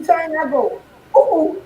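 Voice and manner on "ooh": ooh. Shown as a demonstration. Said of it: "those two O's mean opportunity".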